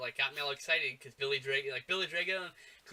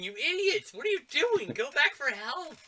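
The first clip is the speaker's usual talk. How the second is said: much higher and louder, yelling at the screen; mock yell at characters; 10 semitones higher, 5 dB louder than usual. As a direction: scream a warning at the idiots on screen. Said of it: "I'm yelling".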